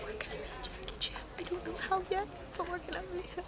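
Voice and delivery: hushed, eager whisper; excited whisper. Real, not acted. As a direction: whisper the plan so no one hears. Plotting quietly in the crowd.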